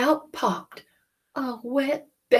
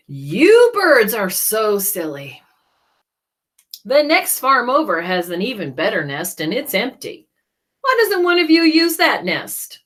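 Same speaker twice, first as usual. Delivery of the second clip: louder scolding tone; a hint of Jack; 2 semitones higher, 11 dB louder than usual. Jack scolding the silly birds, a bit louder. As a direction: talk the birds into leaving.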